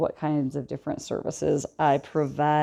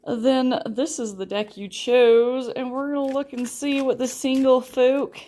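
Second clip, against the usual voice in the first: Southern accent; 5 semitones higher than usual. Real, not acted. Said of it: "Did I just go Southern there again?".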